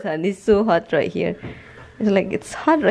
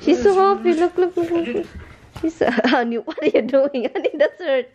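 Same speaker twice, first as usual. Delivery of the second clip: much higher excited pet talk; high cooing; 8 semitones higher than usual. Real, not acted. Owner cooing at the playing dog, high and delighted. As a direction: coo over the happy dog.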